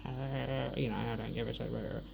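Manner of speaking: low, soft garbled grumble; mock dismissive mumble. Woman mimicking ex's reply to her threat, mock dismissive mumble. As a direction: imitate him grumbling it away.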